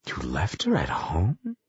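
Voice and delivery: soft, slow disbelief, rising at the end; flabbergasted. A narrator's reading. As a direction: ask in hushed astonishment.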